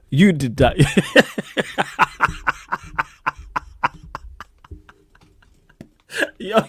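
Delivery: ordinary delivery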